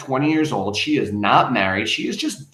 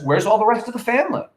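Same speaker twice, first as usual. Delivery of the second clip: higher, quick, mock-innocent sarcasm; sarcastic; 9 semitones higher, faster than usual. Shown as a demonstration. Nosy neighbor needling Ruth's family, sarcastic and quick. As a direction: needle her with a fake-sweet question. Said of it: "it's almost like she's being sarcastic".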